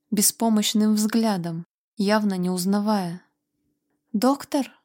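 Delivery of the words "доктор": high, lightly whimpered plea; a mild narrated whine, pitch up; whimpering. A narrator's reading. Feverish Guido begging the doctor, mild whimper. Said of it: "прохныкал он".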